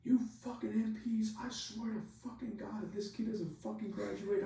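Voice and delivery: very high-pitched mock tirade; imitated angry rant. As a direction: rant like a fed-up superior, voice way up. Senior guy slams table, rants.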